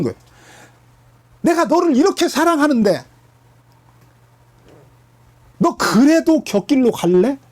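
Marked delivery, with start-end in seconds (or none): 1.4-7.4 tearful pleading. Retold or imitated. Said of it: "막 눈물을 흘리면서 사정하는 거예요".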